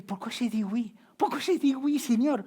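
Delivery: plus aigu, qui monte vers la supplique; plainte angoissée. Retold or imitated. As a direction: rejouer la panique d'avant le sermon. (plainte à Dieu, plus aiguë)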